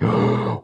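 sharp high intake of breath; gasp of shock. Retold or imitated. Fan recalling the Winter Soldier reveal, shocked gasp. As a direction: gasp as if the twist just hit.

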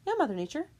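sarcastic high call to Mother Nature; mock taunt. Real, not acted. Vlogger taunting Mother Nature, high and sarcastic.

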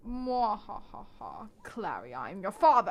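slow, hammed-up evil laugh and reveal; evil villain. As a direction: gloat like a movie villain. Villain Valentine reveals himself.

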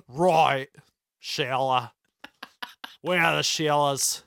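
loud, halting, hammed-up Australian; mock Australian accent. Host faking being Australian for co-host, hammed-up accent. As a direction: improvise badly in broad Australian. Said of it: "I'm not Australian".

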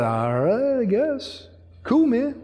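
drawn-out, hesitant going-along reply; noncommittal Abram. Acted. Abram answering God's call to move, laid-back and unsure. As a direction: shrug it out slowly, then lift into easy agreement.

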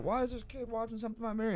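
high-pitched imitation of an upset man; lightly hammed-up indignation; mock upset uncle. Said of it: "he got all upset".

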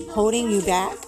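ordinary delivery

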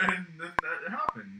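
ordinary talk

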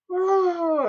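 high shocked "Oh" from the teacher; shocked gasp. Retold or imitated. Teacher overhears a dirty joke, high shocked gasp. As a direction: catch your breath in shock.

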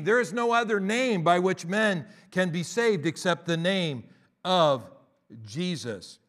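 loud preaching, drawn-out slow words on hallowed, sacred, holy; full-force preaching. Acted. Pastor preaching to the congregation about the sacred name, loud and slow. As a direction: preach it, leaning hard on each holy word.

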